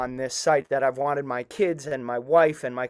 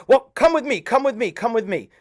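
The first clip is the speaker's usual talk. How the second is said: eager urging; 6 semitones higher than usual. Retold or imitated. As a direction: hurry him along, the urge dying away. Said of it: "And he said".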